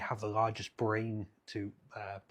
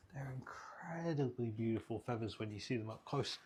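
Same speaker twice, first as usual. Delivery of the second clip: ordinary talk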